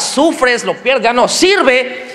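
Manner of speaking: usual speaking voice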